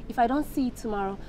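quiet, firm ultimatum; threatening. Acted. Woman giving her boyfriend a deadline, quietly threatening. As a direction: lay down the threat softly, without wavering. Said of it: "what are you threatening me".

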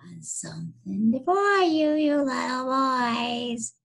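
put-on high, loud singsong girlish call; shrill girlish voice. Billy taunting fleeing boys from a stump, shrill girlish. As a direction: trill it slowly, stretching the ends. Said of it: "in a shrill, girlish voice".

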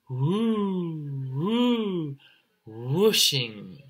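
"Woo, woo, whooshing" with long, high swooping oo; extended wind words. Shown as a demonstration. Teacher shows the extended spelling, "Woo, woo, whooshing" drawn out. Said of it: "it does help you to extend the word out".

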